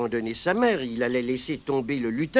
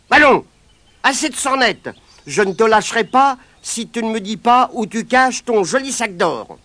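put-on loud, higher boyish warning; firm boy's threat; 6 semitones higher, 8 dB louder than usual. Pat threatens the wriggling leprechaun, loud and higher. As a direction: lay down the law.

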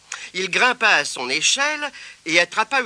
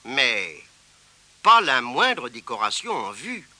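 ordinary delivery

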